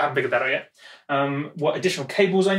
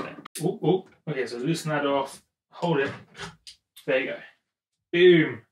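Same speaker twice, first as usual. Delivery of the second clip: ordinary talk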